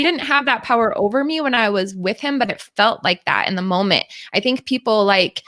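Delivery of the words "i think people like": normal talking voice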